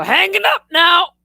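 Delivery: high, shouted abrupt grandpa voice; mock grandpa shouting goodbye; grandpa's curt sign-off. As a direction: cut the call off like a grumpy old man. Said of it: "he'd go".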